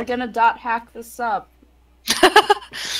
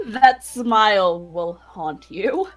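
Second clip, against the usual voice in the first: mock ominous; 3 semitones lower, 5 dB quieter, much slower than usual.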